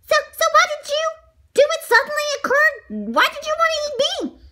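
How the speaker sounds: high, loud indignant little voice; outraged donut character. A narrator's reading. Donut confronts his would-be eater, shrieking outrage. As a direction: scold him in a high, loud squeal.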